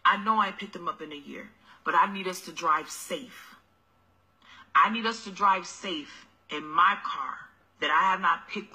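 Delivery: low, soft sweet-talking hustler voice; mock broke boyfriend. Deadbeat dad wheedling.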